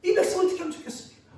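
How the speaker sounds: anguished prophet pressing God, high and loud; pleading protest. Acted. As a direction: demand an answer from God, fast and urgent. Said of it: "그렇게 하나님께 따지면서".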